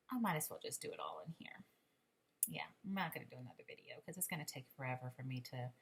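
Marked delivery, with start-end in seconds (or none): 2.5-5.7 quick whispered aside; whispering. Real, not acted. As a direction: whisper it fast under your breath. Sudden aside about not filming.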